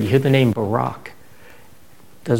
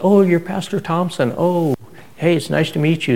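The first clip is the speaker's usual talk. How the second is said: higher, bright surprised greeting; sudden warm welcome; 6 semitones higher than usual. Retold or imitated. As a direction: gush a little once you know the name. Churchgoers suddenly greet warmly.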